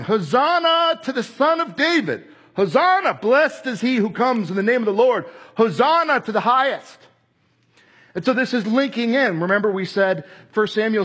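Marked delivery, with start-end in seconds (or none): 0.0-7.0 crowd shouting. Retold or imitated. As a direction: hail the king at the top of your voice. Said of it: "and they're shouting".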